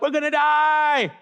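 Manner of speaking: drawn-out high wail of mock panic; freaking out. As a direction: wail it slowly like a hammed-up doomed sailor. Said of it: "they're freaking out".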